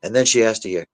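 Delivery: ordinary delivery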